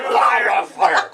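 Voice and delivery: mock shout. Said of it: "He said calmly".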